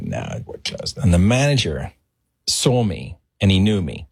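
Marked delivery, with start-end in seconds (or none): 0.0-1.0 whispered refusal at the door; clerk turning him away. Retold or imitated. Clerk at the locked door refusing him, whispered. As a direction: breathe it out, no voice. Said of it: "and he's like".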